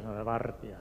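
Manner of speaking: usual speaking voice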